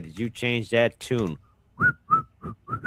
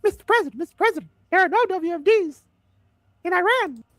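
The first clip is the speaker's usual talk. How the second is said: much higher mock-pleading voice; Tulsi impression. Host mocking Tulsi pleading with the president, high falsetto. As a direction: squeak it out like a fawning Tulsi. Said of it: "spot-on impression of, of Tulsi".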